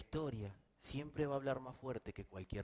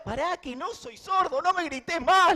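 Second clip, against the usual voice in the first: high, loud, exasperated demon voice; the annoyed devil yelling back; devil talking back; 16 dB louder than usual. Retold or imitated. The devil snaps back at the shouting minister. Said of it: "le dice".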